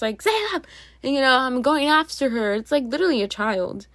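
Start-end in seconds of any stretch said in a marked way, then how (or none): 0.2-0.6 sharp high call to the cat. Real, not acted. Owner calling the cat across the room. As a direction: call her name to stop her.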